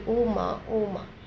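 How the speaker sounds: mild sobbing. Retold or imitated. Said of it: "बस सुबकती रही".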